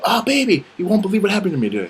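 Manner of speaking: high, loud gushing news; mock excitement. Boyfriend telling girlfriend about the day, hammed-up excitement. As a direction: gush it high, like huge news.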